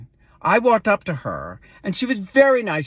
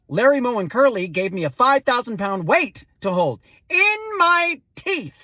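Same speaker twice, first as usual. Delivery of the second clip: ordinary delivery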